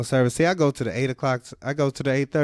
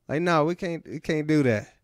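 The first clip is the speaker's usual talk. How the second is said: normal talking voice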